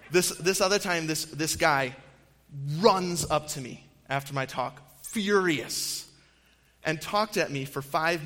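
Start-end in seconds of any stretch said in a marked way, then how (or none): none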